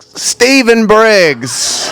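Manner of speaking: embarrassed slow slate; slow nervous slate. Acted. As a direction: drag out your own name shakily. Nervous slate after throwing up.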